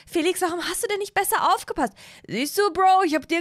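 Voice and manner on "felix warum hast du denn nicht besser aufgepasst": higher-pitched disappointed mother scolding; higher mom voice telling the boys off. Acted.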